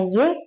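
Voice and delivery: Y said "yeh", one syllable; name the letter Y as "ye"; Indonesian Y. Shown as a demonstration. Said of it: "Kita bahasa Indonesia ngomongnya Y". (Y dibaca "ye" ala Indonesia)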